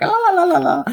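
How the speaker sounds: high-pitched loony la-la-la; crazy babbling. Acted. Teacher heads to the crazy home, high mad babble. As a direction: babble la-la like a cartoon lunatic.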